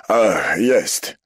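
loud, high cry of discovery; exclamation. A narrator's reading. Prefect finding the letter, exclaiming loudly. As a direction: call out the find. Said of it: "zawołał".

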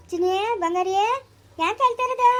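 high, lifted cooing; baby talk. Acted. Adult asking a crying darling what is wrong, high baby talk. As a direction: coax the little one out of crying.

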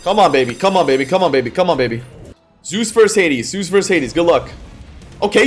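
usual speaking voice

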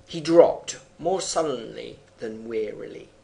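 measured poem recitation; poem reading. Acted. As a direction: read the verse with weight. Reads poem aloud.

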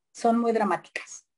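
usual speaking voice

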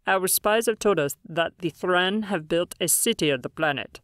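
measured, otherworldly alien elder; calm alien leader voice. Acted. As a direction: speak as a grave alien leader.

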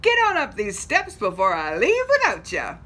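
loud friendly call; calling back. Acted. Annie calls back to stranded drivers, loud friendly call. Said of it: "called back to the snowbound drivers".

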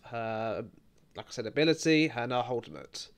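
normal talking voice

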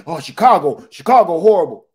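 loud mocking complaint; mock the naysayers loudly; loud mock outrage about Chicago; people complaining. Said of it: "people be like".

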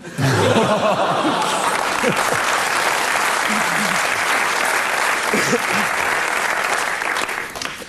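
breathy high laugh. Real, not acted. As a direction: burst into airy laughter. Laughs at own joke.

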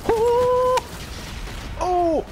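very high, loud "oh" cries; excited scream. Real, not acted. Player at the climax of the match, excited scream. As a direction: scream out two high ohs.

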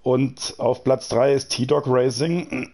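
usual speaking voice

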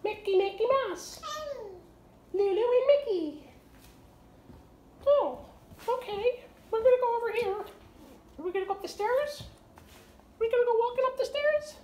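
high, sing-song toy mouse voice; playful Mickey voice. Pretend. Parent voices Mickey toy for toddler.

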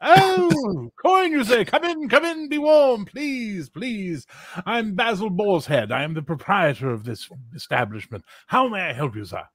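very high, sugary welcome; suddenly all smiles for the money; very high fawning host; fawning innkeeper. Acted. Innkeeper turns fawning at coin.

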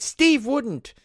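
high, loud mock scream of a girl. Quoting the trailer girl, high mock scream. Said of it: "that's what the girl's screaming throughout".

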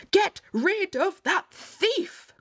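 high, slow, commanding queen voice; crying-out queen. A narrator's reading. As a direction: cry out a royal order. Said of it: "cried the queen".

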